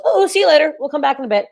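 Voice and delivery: high-pitched breezy goodbye; playful, high send-off; cheery mock farewell. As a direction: give the departing face a high little voice. Voices the face leaving briefly.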